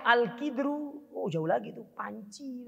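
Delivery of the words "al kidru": al-kidru said slowly with the k sound as ki; kasra reading. Shown as a demonstration. Teacher demonstrating the wrong kasra reading. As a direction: drawl al-kidru with an i vowel. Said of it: "harokat kofnya kasro".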